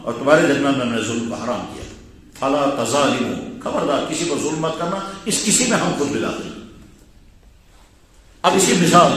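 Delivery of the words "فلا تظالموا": slow Arabic recitation. Acted.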